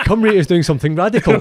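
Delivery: talking through laughter, high and loud; laughing. Real, not acted. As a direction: giggle through the words. Joking about league expansion.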